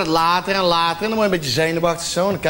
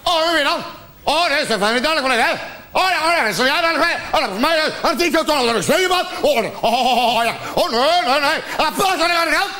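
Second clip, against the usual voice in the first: mock interrogation; 7 semitones higher, faster than usual. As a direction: grill her like a paranoid interrogator. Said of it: "Gestapo-verhoor".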